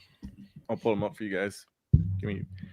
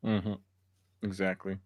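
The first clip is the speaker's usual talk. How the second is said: normal talking voice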